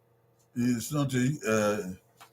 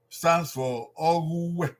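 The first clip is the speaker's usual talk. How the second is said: ordinary delivery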